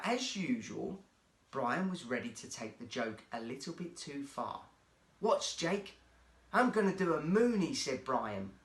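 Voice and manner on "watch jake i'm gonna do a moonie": cheeky show-off. Acted. Brian shows off on bus.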